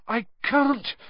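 high-pitched, struggling for breath; effortful high protest; panting. A narrator's reading. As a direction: strain it out while panting. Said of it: "Harry panted".

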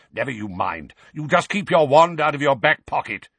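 put-on gruff old wizard's dismissal; growling. A narrator's reading. Moody brushing off the witch, gruff growl. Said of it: "growled Mad-Eye".

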